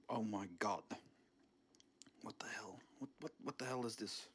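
ordinary talk, nothing marked

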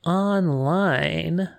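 "I am online" stretched like a grand announcement; announcer voice. Acted. Announcing own online presence.

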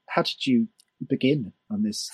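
normal talking voice

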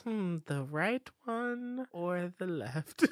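teasing. Retold or imitated. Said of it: "and then she's like".

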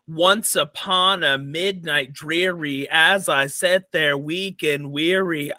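slow, every other syllable hit hard; trochaic stress. Shown as a demonstration. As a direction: beat out the stressed-unstressed rhythm slowly. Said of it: "It is actually one stressed syllable, then one unstressed".

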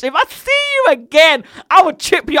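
loud, high, heated warning; hammed-up vow of revenge; mock threat. Worker threatening the absent rude customer, playfully furious.

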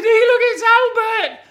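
high, loud squeal of surprise; surprised mother. Acted. Mother seeing Albert at window, squealing. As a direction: shriek like a startled mum.

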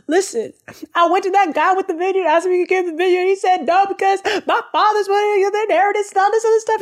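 high, breakneck mock-tearful complaint; whining king. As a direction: blurt it out like a sobbing child tattling. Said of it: "And the king goes".

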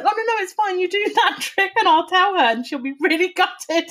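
high, laughing fake reassurance; mock reassurance. Reassuring the crestfallen magician, high and laughing. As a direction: reassure him brightly while giggling.

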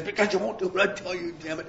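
hammed-up livid outburst; acted fury. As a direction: play Balaam snapping back at his donkey. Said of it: "he's so ang-, angry and livid".

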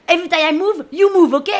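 high, loud crewmate's command; barked order. Acted. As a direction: shout the rule at someone in your way. Crewmate orders her out of his way.

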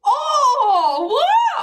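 very high, loud disbelief; shocked outcry. Real, not acted. Friend hearing cycling shorts ick, shocked shriek. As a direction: shriek it in laughing outrage.

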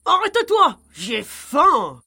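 loud pig-character shout; grunting hungry piglet. A narrator's reading. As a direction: bellow it like a hungry little pig. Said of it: "Il courut après lui en grognant".

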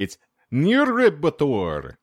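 Niribator said slowly, syllable by syllable; careful pronunciation. Shown as a demonstration. Host reads a Hungarian place name, slow careful try. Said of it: "not try to pronounce this".

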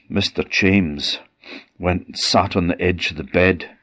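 ordinary delivery

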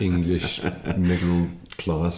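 slow, drawn-out English middle-class accent; lightly exaggerated transatlantic drawl. Shown as a demonstration. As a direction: stretch the English middle-class vowels slowly. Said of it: "transatlantic drawl".

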